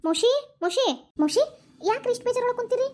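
high-pitched, concerned call for attention. Acted. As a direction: call out to her, then ask what is wrong. Concerned woman calls out to downcast aunt.

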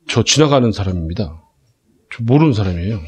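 low, subdued polite denial; feigned innocent bystander. Retold or imitated. Friend to police denying he knows him, low and deadpan. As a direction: play the friend quietly disowning his mate.

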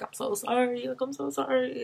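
put-on a mother quietly apologizing to her unborn baby, tearful; tearful apology. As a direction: whisper it to the baby like a broken plea.

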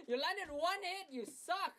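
high laugh into a loud taunt; mocking laugh. Real, not acted. Mocking an opponent who landed one hit, laughing high. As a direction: laugh at him, then jeer loud.

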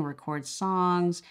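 ordinary talk, nothing marked

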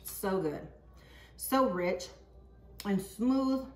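talking around a mouthful of soup; mouth full. Real, not acted. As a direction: speak while still chewing. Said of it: "talking with your mouth full".

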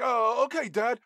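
high, mock-dopey obliging son; Paul Walker. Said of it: "Paul Walker's like".

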